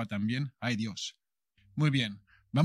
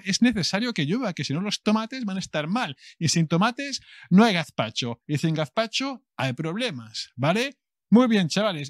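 excited; 6 semitones higher, 4 dB louder than usual. Acted. Energetic opening rant.

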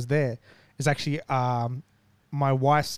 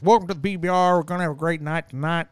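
lightly imitated American announcer; retold announcer's welcome; American event announcer; 3 semitones higher, 3 dB louder than usual. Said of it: "the American guy who stands there goes".

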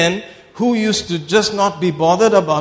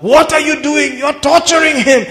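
high, loud alarmed outcry; retold shout; 8 semitones higher, 5 dB louder than usual. Onlooker in the crowd shouting at the preacher, high and loud. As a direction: yell it like a horrified onlooker. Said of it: "somebody would shout".

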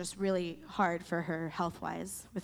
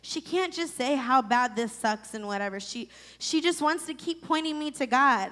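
louder and higher, venting frustration; angry rant; 5 semitones higher, 5 dB louder than usual. Real, not acted. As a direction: let the anger spill out. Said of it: "I was angry".

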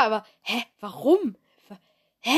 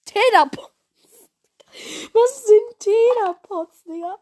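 baffled; 9 semitones higher, 3 dB louder, slower than usual. Real, not acted. Baffled by the odd product.

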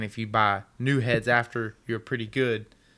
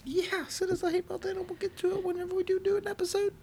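high squeaky mock-sweet voice; 5 dB quieter, faster than usual. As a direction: talk like a cartoon chipmunk. Same high put-on voice.

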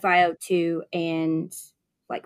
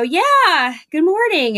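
high, loud bright hello; cheerful greeting; 10 semitones higher, 6 dB louder, much slower than usual. Retold or imitated. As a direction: greet him brightly and loudly. Nurse greets cheerful patient.

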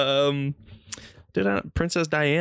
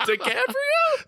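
high, slow, giggling question; half-laughing a silly guess; much slower than usual. Real, not acted. Friend guessing a name while laughing at himself.

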